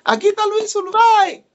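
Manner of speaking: high, loud proclaiming cry; excited announcement. Retold or imitated. Young preacher repeating the name to the church, high proclaiming cry. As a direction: announce him to the whole church, triumphant.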